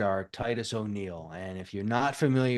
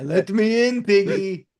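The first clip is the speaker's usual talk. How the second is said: playful villain voice; 8 semitones higher, 7 dB louder than usual. Retold or imitated. Villain voice in joke movie.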